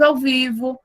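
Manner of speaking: ordinary talk, nothing marked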